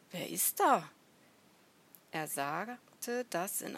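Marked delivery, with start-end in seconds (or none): 0.1-0.9 raised-voice challenge into the bushes; wary shout, louder on the end; calling out. A narrator's reading. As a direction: call out to someone unseen. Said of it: "drehte sich zu ihm um und rief".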